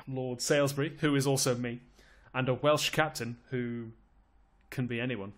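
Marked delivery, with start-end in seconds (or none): none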